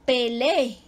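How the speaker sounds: "pelé" as pe-lé-e with a long ending; double E. Shown as a demonstration. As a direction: stretch the final e of "pelé" into two vowels. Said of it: "va separada E E".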